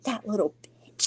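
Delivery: hushed, voiceless whisper of spite; whispered insult. Retold or imitated. Girl hearing about the bully, whispering with spite. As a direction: breathe it out under the breath.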